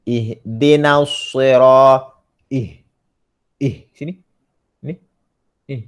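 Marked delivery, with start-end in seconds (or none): 0.1-2.1 "Ihdina" drawn out slowly, breathy h from the throat; slow demo. Shown as a demonstration. Teacher demonstrates Ihdina to student, slow and deliberate. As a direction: sound out "Ihdina sira-" slowly. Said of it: "Macam mana kita nak sebut?".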